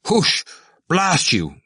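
high, angry shooing cry. A narrator's reading. Cranley clapping Temple away, crying out. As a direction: shoo him off with a curse. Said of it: "Cranley cried".

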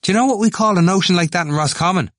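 fast, high, loud challenge; crying out. A narrator's reading. Temple taunting as he moves away, crying out. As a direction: fire the question out high and fast. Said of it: "he cried".

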